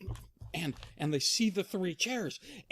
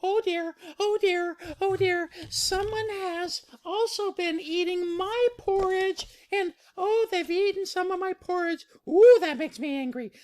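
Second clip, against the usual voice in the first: very high falsetto, building louder; Mama Bear fretting, then angry; 4 dB louder than usual. Acted. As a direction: fret high, then work up to crossness. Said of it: "Mama Bear goes".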